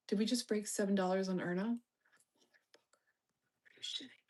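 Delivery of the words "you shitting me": breathy stunned whisper; barely voiced shock; whispered disbelief. Real, not acted. Stock dropped, disbelief.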